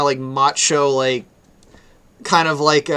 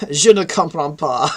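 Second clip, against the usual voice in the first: French phrase. Acted. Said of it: "I do know a little bit of French".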